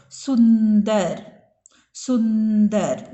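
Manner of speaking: सुंदर drawn out slowly, the n clearly sounded; slow, stressed nasal. Shown as a demonstration. Teacher modelling the word slowly for the class. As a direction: stretch सुंदर slowly and lean on the n. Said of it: "न का sound कहाँ पे आता है?".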